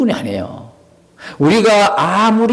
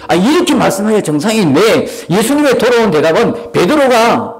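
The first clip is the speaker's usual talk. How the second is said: usual speaking voice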